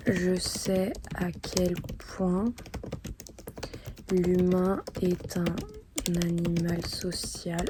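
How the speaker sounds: slow, halting words with long pauses; dictating while writing. Real, not acted. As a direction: say each phrase as you write it down. Composes letter aloud while writing it.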